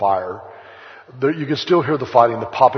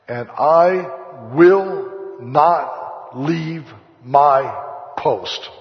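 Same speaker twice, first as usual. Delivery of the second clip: slow, weighty, each word leaned on; defiant vow; 4 semitones higher, 4 dB louder, much slower than usual. Retold or imitated. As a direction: plant the words like a refusal. Chaplain's defiant vow quoted.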